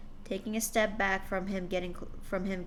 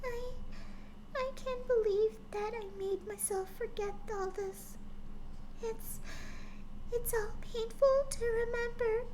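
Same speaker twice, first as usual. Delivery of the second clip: high, soft, trembling little pony voice; frightened daughter remembering her father, high and soft; soft whimper; 14 semitones higher, 6 dB quieter than usual. Acted. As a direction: whimper it softly, much higher. Fluttershy voiced in story.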